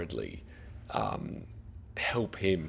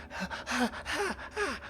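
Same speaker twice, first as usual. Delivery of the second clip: yelling laugh. Acted. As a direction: let a frantic ha-ha burst out. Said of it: "he just starts yelling".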